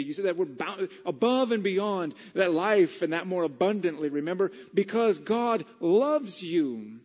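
loud, high, emphatic preaching; full-force preaching. Real, not acted. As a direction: preach it to the whole room.

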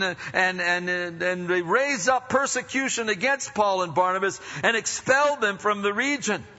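high, driving pulpit delivery; full-force preaching. Real, not acted. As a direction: tell the Bible story with preacher's fervour.

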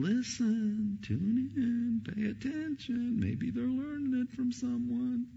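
high, lightly voiced divine nudge; God speaking. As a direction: gently tell them to pay attention. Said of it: "God's going".

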